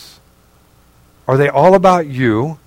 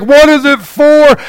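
despairing cry; 11 semitones higher, 6 dB louder than usual. Retold or imitated. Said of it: "or like".

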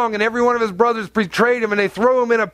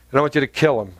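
hint of God's low voice; 6 semitones lower, faster than usual. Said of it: "God tells Abraham".

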